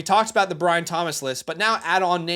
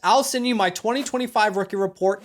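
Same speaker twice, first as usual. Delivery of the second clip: upbeat sponsor read; lively sponsor plug; 4 semitones higher than usual. Acted. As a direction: pitch the app like a host ad.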